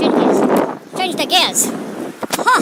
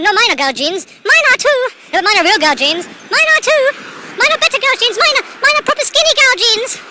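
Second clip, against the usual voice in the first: mock squabbling boys, louder and higher with each retort; arguing boys; 8 semitones higher, 8 dB louder than usual. Two boys fighting over whose jeans are girl jeans, loud mock arguing. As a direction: act out a heated, one-upping playground argument. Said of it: "They were arguing about whose jeans".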